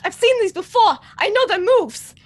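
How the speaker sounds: high, loud playful cry; excited in-character boast. Acted. Player boasting as McCoy dodging aliens, excited cry.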